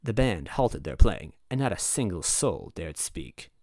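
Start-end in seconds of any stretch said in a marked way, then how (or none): none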